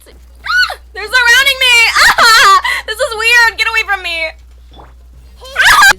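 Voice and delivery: high, loud frightened shrieks; panicked screaming. Real, not acted. Gamer shrieking as enemies surround her. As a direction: scream as they close in on you.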